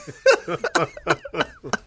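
long falling laugh; laughing hard. Real, not acted. After the Costa punchline, long laughing fall. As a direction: let the laugh roll out. Laughing at his own story.

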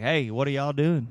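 lightly put-on Texan twang; Texas accent. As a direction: greet the gang in casual Texan. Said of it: "when I talk like that".

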